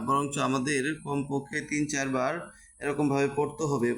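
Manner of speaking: ordinary talk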